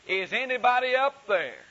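high, loud call upward, pleading; desperate cry again. Acted. Dangling man calls again for help, loud and pleading. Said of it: "cried out again".

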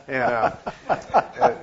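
high laugh bursting through the filler words; real laughter. Real, not acted. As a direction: laugh out loud, slowly, mid-sentence. Laughing at his own story.

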